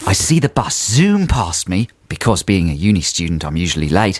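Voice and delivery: put-on Aussie accent for a letter; comic Australian letter-reading. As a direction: read it out as a mock Australian. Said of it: "carry on in the correct accent".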